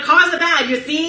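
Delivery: put-on high, loud old-timey newsreel voice; 1930s voice. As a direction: put on a hammy 1930s accent. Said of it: "I had to do the voice!".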